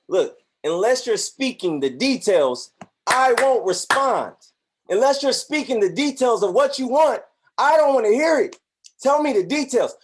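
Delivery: loud, rising demand for every detail; God demanding details. Acted. God insisting to the believer, loud and demanding. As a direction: boom it out like God wanting specifics.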